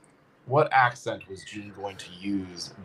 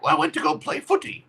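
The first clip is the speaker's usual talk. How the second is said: much higher, hammed-up Cockney accent; Cockney Gene; faster than usual. Host quoting Gene's laundry episode line in high mock Cockney. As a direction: play a cheeky Cockney schoolboy. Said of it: "Where Gene's like".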